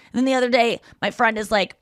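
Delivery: usual speaking voice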